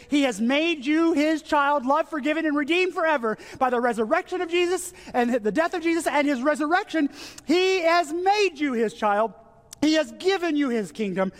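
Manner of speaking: high, fervent pulpit climax; impassioned preaching. Acted. Pastor proclaiming God's adoption to the congregation, impassioned. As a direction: lift them up with the promise. Preaching rising to full force.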